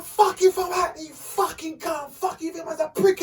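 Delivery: high-pitched cussing outburst; losing it, retold. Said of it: "I lost it".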